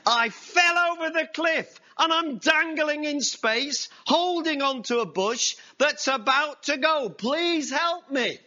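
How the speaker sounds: high, loud panicked shout; frantic plea. Acted. Young man dangling in space shouts for help, panicked. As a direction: yell it out desperately. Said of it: "the young man replied".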